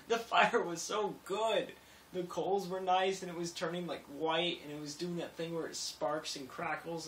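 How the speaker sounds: fond gushing. Real, not acted. Fond recollection of the fire.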